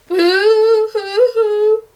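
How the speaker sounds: high, slow, wailing boo-hoo; long drawn-out childish boo-hoo; child crying. A narrator's reading. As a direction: sob it out like a small child. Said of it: "A child was crying".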